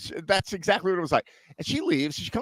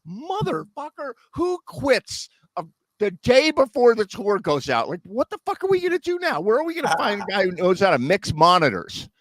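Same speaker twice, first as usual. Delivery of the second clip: high, exasperated cursing roadie; retold griping roadies, pitched high; mock cursing; 8 semitones higher than usual. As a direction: fume about the quitter in a raised pitch. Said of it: "they're cursing up a storm".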